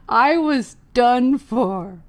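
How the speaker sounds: rueful laugh through the line. Real, not acted. Comic recalling her doomed show, laughing. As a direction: laugh it off, slow and loud.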